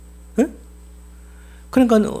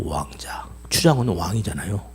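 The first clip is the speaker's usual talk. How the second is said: dramatic whisper; 11 semitones lower, 6 dB quieter than usual. Real, not acted. Preacher reveals the slave is a prince.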